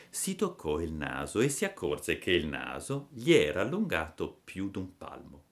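plain reading voice

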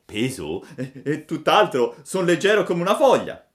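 stammer, then insist brightly; excited child puppet; eager protest. A narrator's reading. Puppet eagerly denies being heavy.

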